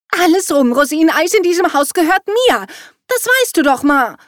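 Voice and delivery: put-on high, loud indignant teenager; teen outburst. A narrator's reading. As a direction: lay down the law to mum. Teen daughter scolding her mother. (lautstark empört, hoch)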